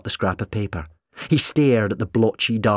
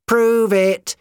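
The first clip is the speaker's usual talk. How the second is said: much louder, higher roar; 8 dB louder than usual. Acted. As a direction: bellow the challenge. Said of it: "he roared".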